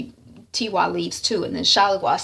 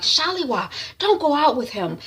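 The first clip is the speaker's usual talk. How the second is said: higher pleading call; urgent appeal; 7 semitones higher than usual. Retold or imitated. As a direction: implore a sister to stay. Sister pleads before date.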